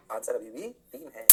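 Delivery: slow, subdued statement of the answer; measured answer reveal. Shown as a demonstration. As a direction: drop low and spell the answer out slowly. Teacher reveals answer, first take.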